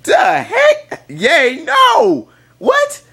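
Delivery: hammed-up high-pitched sports tantrum; mock fan outrage. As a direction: squeal out the disbelief. Outburst at interception.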